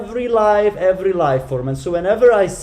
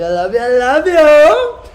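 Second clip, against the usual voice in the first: high, loud gushing; loud, high, gushing I love you; excited love outburst; 10 semitones higher, 10 dB louder than usual. Real, not acted. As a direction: burst out with joy to the viewers. Farewell love, escalating.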